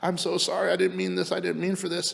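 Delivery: lightly acted tearful remorse; mock tearful apology. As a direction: plead high, mimicking a crying woman. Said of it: "And she's crying now".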